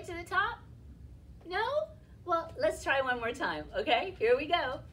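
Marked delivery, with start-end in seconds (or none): none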